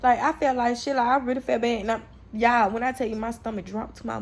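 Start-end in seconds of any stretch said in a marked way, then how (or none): none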